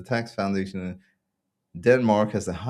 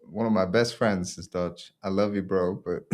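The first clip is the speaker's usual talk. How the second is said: usual speaking voice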